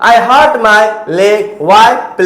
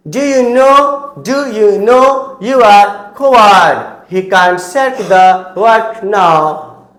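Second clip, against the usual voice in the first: slow, careful sentence-by-sentence pronunciation drill; slow model reading; slower than usual. Shown as a demonstration. Teacher reading English sentences aloud to students, slow and deliberate. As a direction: read each sentence slowly and clearly so learners can copy it. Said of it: "मैं अब सिर्फ English में pronunciation करूंगा".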